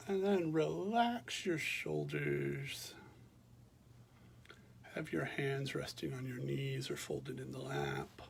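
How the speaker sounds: calm, unhurried meditation voice with long pauses; guided meditation. Real, not acted. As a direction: guide listeners gently through a body scan, leaving space after each phrase. Leads a body scan meditation.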